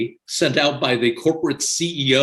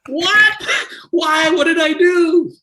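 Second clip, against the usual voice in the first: high, loud retold cry of disbelief; shocked outcry; 6 dB louder, slower than usual. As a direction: yelp it out like the floor just dropped. Retold shock at being fired.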